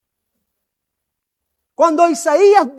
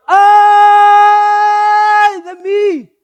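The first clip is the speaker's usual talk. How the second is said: loud, very slow anguished wail, pitched higher; a prophet's howl of despair, held long and loud; drawn-out wailing cry; 6 semitones higher, 8 dB louder, much slower than usual. Shown as a demonstration. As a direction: stretch out a desperate cry of woe. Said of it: "¿Cómo creen que lo diría?".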